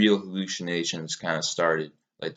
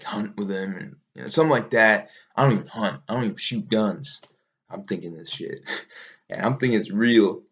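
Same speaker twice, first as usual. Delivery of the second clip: normal talking voice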